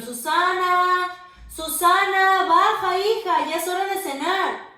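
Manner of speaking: loud, high, slow sing-song call. Acted. Parents calling Susana down to dinner, loud sing-song call. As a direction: call her down from upstairs.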